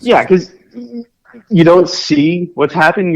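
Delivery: ordinary delivery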